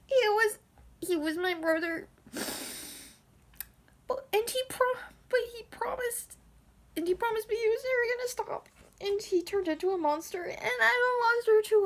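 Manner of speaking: high-pitched hammed-up weepy voice; mock tearful. Younger brother grieving the brother he shot, mock crying. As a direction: wail it like a melodramatic confession. Acting Andy's tearful confession.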